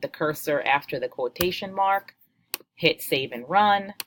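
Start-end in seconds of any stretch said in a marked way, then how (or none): none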